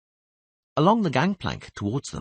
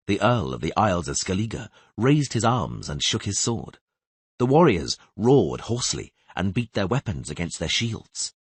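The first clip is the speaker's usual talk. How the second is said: ordinary delivery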